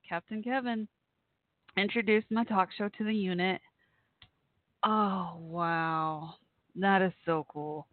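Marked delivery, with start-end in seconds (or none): 4.9-7.9 choked up. Real, not acted. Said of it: "you're gonna make me cry".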